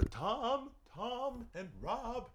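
high title call with fading echoes; mock echo announcement. Mock echoing show title announcement.

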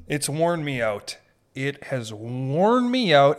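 ordinary delivery

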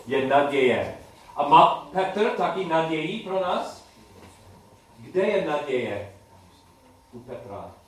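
usual speaking voice

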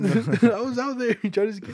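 laughs through the words; giggling as he talks; laughing. Real, not acted. Laughing while telling it.

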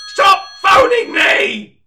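loud, very high screaming outburst; furious yell. Acted. Host screaming at callers to stop phoning. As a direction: scream it at the phones.

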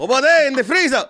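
shouted high, clerk pointing the way; high loud bodega clerk. Retold or imitated.

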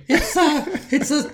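mock Italian plumber, high and laughing; Mario Italian accent. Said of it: "Mario Brothers impersonation".

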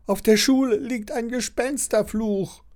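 high-pitched schoolboy call. A narrator's reading. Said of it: "ruft Andreas". (hoher, aufgeregter Ausruf eines Schülers)

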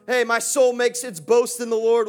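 loud, fervent preaching to the church; rousing sermon delivery; full preaching. Acted. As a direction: preach it out and rally the room.

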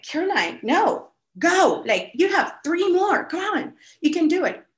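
high-pitched coaching push, cheering her on; a coach on the poolside urging a tired swimmer, high and eager; pushy coach cheer. Retold or imitated. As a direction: urge her on like a keen swim coach.

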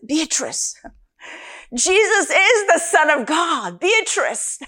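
high, eager, half-laughing announcement; excited wake-up call. Retold or imitated. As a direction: shake her awake with the big news. Waking roommate with urgent news.

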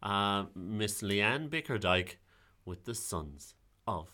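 high, grand showman intro; announcer voice. Pretend. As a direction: announce her like a host. Introducing the storyteller.

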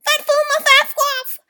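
high, loud, slow mock scream; imitated scream. Owner imitating the dog's nightly scream, loud and high. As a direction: wail it like a dog woken at night. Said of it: "he wake up screaming".

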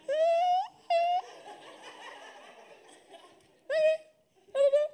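very high, squeaky, laughing uncertainty. Shown as a demonstration. Showing the congregation how doubt sounds, very high and wavering. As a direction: let the voice climb up with doubt. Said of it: "the higher your voice goes, the more uncertain you are".

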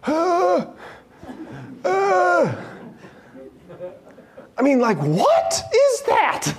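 stunned by beauty, voice lifted high and slow; awestruck. Real, not acted. Awed at the cathedral ceiling.

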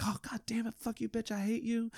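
small, high mock-screaming child voice; cussing little boy. Young patient cursing at nurse with needle, imitated little-boy tantrum. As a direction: imitate a kid cursing, high but held back in volume. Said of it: "he starts cussing and screaming".

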